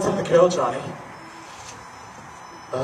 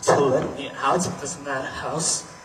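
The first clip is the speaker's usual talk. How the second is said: low, quiet plea; hushed entreaty; 11 semitones lower, 10 dB quieter than usual. Acted. Inmate entreats the doctor to let him out, hushed and low. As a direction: beg softly and low.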